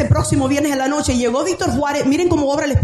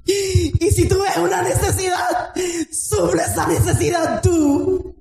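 fervent sermon, raised and calling out; impassioned preaching; 4 semitones higher, slower than usual. Acted. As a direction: preach it hard to the congregation. Preaching at full force. (predicación fervorosa a voz en cuello)

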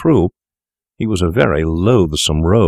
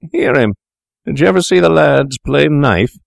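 higher, louder jeering stranger; drunken taunt; 9 semitones higher, 3 dB louder than usual. Acted. Stranger mocks Macfarlane in the tavern, loud jeering. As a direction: crow it out like a drunk bully.

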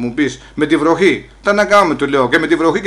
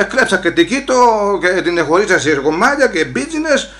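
ordinary talk

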